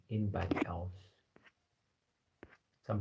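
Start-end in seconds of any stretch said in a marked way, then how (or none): 0.1-0.9 whispered. Real, not acted. Declaring loyalty to partner.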